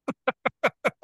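high delighted laugh; real laugh of surprise; surprised laugh. Real, not acted.

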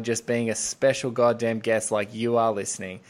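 ordinary delivery

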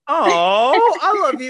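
high, loud gushing delight; real thrilled squeal; excited gush. Real, not acted. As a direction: gush like you found a soulmate. Matching tattoo, gushing delight.

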